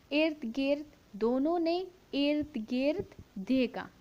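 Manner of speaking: slow, clear story reading for a class; measured classroom read-aloud. Shown as a demonstration. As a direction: read it out word by word for the children. Teacher rereads the line slowly.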